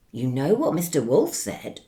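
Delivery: ordinary delivery, nothing marked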